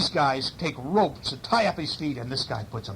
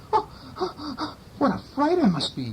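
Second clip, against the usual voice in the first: Scamp dismayed; 14 semitones higher than usual. A narrator's reading. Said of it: "thought Scamp".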